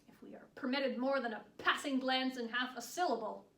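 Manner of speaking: loud, biting jab; pointed indignation. Acted. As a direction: raise your voice so the guard hears the complaint. Bitter jab aimed at the guard.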